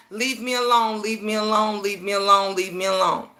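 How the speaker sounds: low, soft, monotone repetition; hushed, low mock plea on one note; quiet begging. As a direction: imitate a quiet plea to be left alone, repeated evenly. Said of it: "sit back and be quiet and".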